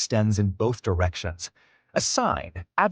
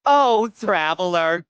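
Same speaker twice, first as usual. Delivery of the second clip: high, slow little gnome pleading; a tiny creature begging, higher; 8 semitones higher, 4 dB louder than usual. Acted. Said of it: "he says".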